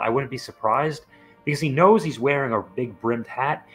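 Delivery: ordinary delivery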